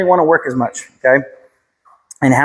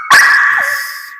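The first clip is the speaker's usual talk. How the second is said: high marker. Real, not acted. Marker word to dog.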